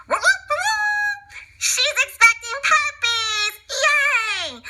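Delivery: excited cheering. Acted. Household cheers that Perdita is expecting puppies, high excited whoops. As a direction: whoop for the puppy news.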